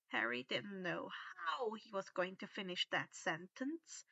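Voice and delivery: ordinary delivery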